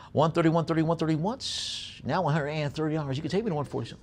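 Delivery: brisk auction chant, pushing for bids; auctioneer bid call. Real, not acted.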